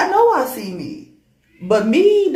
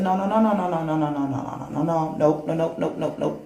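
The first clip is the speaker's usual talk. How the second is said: panicked refusal; 5 semitones lower, 3 dB quieter than usual. Acted. Refusing her talking reflection in panic.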